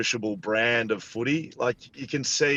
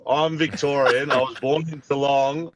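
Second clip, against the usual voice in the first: ordinary talk, nothing marked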